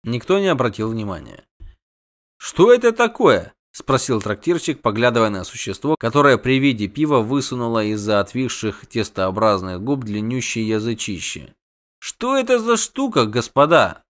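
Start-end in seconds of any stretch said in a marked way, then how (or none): 2.4-3.5 high-pitched astonishment; startled question. A narrator's reading. Innkeeper eyes the creature's tongue, startled. 12.0-14.0 high-pitched puzzled query; astonished question. A narrator's reading. Innkeeper asks gentlemen about the creature, astonished.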